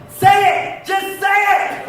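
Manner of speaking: high, loud demand to speak; impatient yell. Retold or imitated. Mom to stuttering son, impatient yelling. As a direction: snap at him to spit it out.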